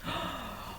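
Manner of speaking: breathy mock-shocked gasp; playful gasp. Teacher gasps playfully at the child's new phrase. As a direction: gasp as if amazed by a secret.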